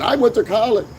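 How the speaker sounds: mock boast. Mimics people boasting about college.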